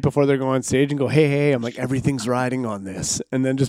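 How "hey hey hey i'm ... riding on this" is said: softer, lower mock warning; confidential aside. Comic to a new comic before stage, hushed aside. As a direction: drop the voice as if pulling someone aside. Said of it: "pull them aside right".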